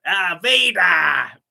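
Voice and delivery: slow, loud, high Emperor shout; Palpatine shout. Acted. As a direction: bellow for Vader like the Emperor. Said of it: "reenact the Robot Chicken Palpatine Death Star phone call bit".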